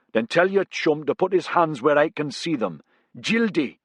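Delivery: high, firm soldier order; soldier's command. A narrator's reading. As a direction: bark the order. Soldier giving orders at gunpoint.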